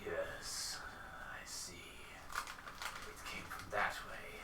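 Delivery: low, hushed, slow orc voice; near-whispered monster musing, drawn out. Acted. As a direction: murmur it slowly as a scheming orc. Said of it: "And the orc goes".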